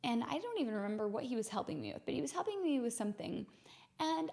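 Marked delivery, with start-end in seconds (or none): none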